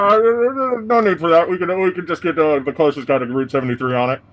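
high, hurried brush-off; nervous cop voice. Acted. NPC cop brushing off dispatcher.

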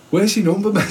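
a steward stopping a runner; mock official asking for the number; marshal's challenge.

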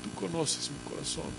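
hushed, intimate plea; prayer in her heart. Acted. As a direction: murmur it privately to God. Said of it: "Ella hacía en su corazón". (oración íntima, casi susurrada)

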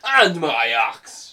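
retry the dwarf line, drawn out; second Gimli attempt. Friend retrying the Gimli line after breaking, slow. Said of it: "I gotta do it again".

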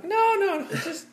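very high-pitched, exaggerated objection; mock protest. Reacting to people who fake being great, mock protest. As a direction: squeal out a hammed-up plea.

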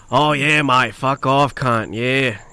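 punchy mock New South Wales Australian; New South Wales accent. Shown as a demonstration. Demonstrating for friends, New South Wales Australian. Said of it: "New South Wales".

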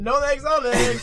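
high, very loud silly announcement; hammed-up name call. Host milking a funny name, loud high hammy call. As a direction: belt the name out for laughs.

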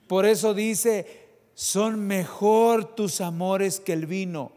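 loud, slow, ringing pulpit proclamation; full-voiced sermon climax; preaching at full force. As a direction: lift it high and drag every word out for the congregation. Preacher builds to a shout.

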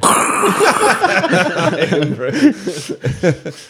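loud high laughing fit; real laughter. Real, not acted. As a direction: burst out laughing. Laughing at the joke.

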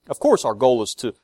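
ordinary delivery

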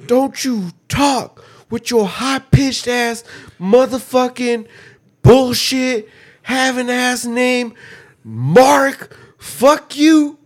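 high-pitched, drawn-out gamer tirade; Call of Duty trash-talker. Pretend. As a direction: spit slow, high insults like a lobby troll. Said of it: "A Call of Duty lobby".

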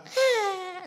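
high, drawn-out little infant whine; soft high baby bleat; imitated baby cry. Imitating a baby's small cry.

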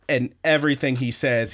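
usual speaking voice

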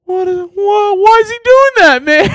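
high, squeaky bewildered sound guy; baffled sound guy. Retold or imitated. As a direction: shriek the question in disbelief. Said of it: "the sound guy's legitimately like".

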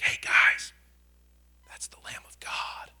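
hushed, breathless whisper of an announcement; mock whisper. Shown as a demonstration. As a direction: whisper it like a timid secret. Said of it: "it wasn't a whisper".

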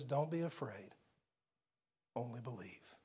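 calm a storm inside a frightened man; Jesus hushing a father's fear, whispered. Acted. Jesus calms the man's mind.

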